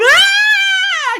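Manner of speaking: high shrill mock kid scream; a child's scream, high and loud; kid's scream. Mimicking screaming kids at the dogs, with a high mock scream.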